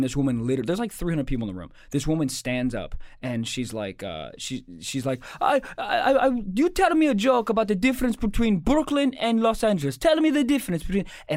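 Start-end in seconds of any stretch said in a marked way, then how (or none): 5.4-11.0 put-on high, loud heckler in an Australian-like accent; mock Australian accent. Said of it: "she was from Australia by that accent".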